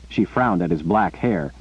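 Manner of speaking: plain reading voice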